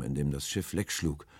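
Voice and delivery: ordinary delivery, nothing marked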